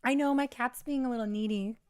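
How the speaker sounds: ordinary talk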